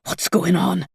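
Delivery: ordinary delivery, nothing marked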